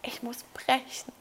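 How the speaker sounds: whispered, about to throw up; nauseous whisper. Retold or imitated. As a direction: whisper it queasily, holding back vomit. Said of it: "Und jetzt stell dir vor".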